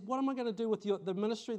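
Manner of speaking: high, loud, excited retelling; bursting with disbelief; amazed. Retold or imitated. Pastor recalling his reply to the volunteer, high and excited.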